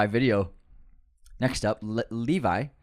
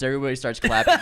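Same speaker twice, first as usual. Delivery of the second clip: ordinary delivery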